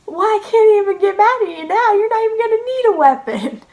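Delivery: high, loud, incredulous giving up; retold exasperated sergeant. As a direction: imitate a sergeant too baffled to scold. Retold sergeant throwing up hands.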